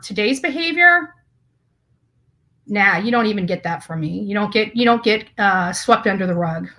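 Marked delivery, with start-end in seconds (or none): none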